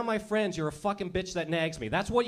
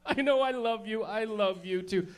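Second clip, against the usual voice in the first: put-on gushing girl; 5 semitones higher than usual. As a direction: coo thanks like a grateful teen girl. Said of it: "And you're like".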